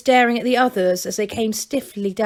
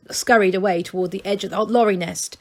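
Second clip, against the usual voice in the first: ordinary delivery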